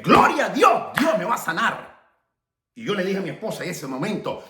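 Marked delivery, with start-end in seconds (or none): 0.0-2.0 high, loud burst of praise; re-enacted cry of thanksgiving; joyful proclamation. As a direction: exclaim it with jubilant faith. Reenacts joyful response to God.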